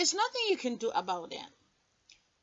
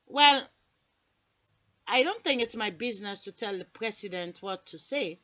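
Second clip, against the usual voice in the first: low operator voice; 4 semitones lower than usual. Acted. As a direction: answer low and dry, barely bothered. Operator voice again.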